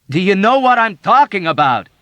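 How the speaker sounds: loud, high, cutting demand; sharp question. Acted. Yossarian sharply questioning the dazed old woman. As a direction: snap the question at her. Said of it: "Yossarian asked sharply".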